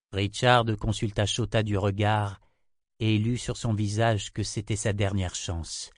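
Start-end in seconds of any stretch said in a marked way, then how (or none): none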